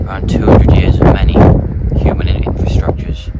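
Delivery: loud, rousing speech to the nation; rally them like a leader; impassioned speech. Acted.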